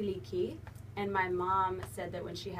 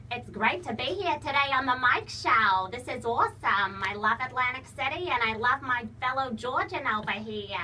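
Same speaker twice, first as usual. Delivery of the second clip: high, loud cartoon mom voice; nasal-free but bright Lois impression, pitched up; Lois Griffin voice; 4 semitones higher, 7 dB louder than usual. Shown as a demonstration. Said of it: "do a Lois Griffin for us".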